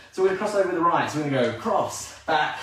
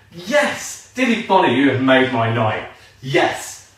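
loud, thrilled cry of yes; delighted shout; 7 dB louder, slower than usual. Real, not acted. Teacher shouting thanks to a viewer who made her night, loud and delighted. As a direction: cheer the comment loudly.

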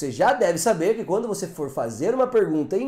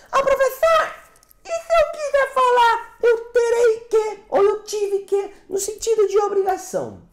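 much higher, louder mock student asking; imitating a student; 4 dB louder than usual. Teacher voicing a student asking the professor, much higher. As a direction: play the puzzled student questioning the teacher. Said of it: "você deve tá perguntando".

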